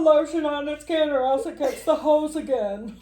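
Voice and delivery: high nasal creepy killer voice; nasal Buffalo Bill voice. Retold or imitated. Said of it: "You're pretty good with that nasal voice".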